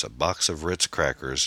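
ordinary delivery

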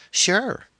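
put-on very high girlish voice; teenage girl. A narrator's reading. Girl replies to friend's mother on phone, high girlish voice. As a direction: pitch it way up like an obliging teen. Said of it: "a teenage girl's voice".

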